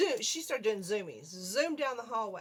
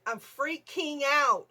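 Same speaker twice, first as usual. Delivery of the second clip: "freaking out" drawn out slowly and loud; 5 semitones higher, 9 dB louder, much slower than usual. Retold or imitated. Telling how the mouse upset her, "freaking out" loudly drawn out. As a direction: stretch each syllable of "freaking out", pitched up. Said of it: "this is what I'm doing".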